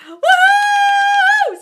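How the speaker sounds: high, loud woohoo shout; excited whoop. Real, not acted. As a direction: whoop for joy. Celebrating success stories.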